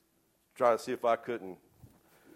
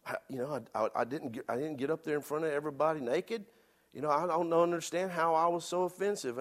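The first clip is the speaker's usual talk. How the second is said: higher, baffled self-defense; baffled inner protest; 6 semitones higher than usual. Retold or imitated. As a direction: puzzle it out, incredulous. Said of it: "I'm going through my mind".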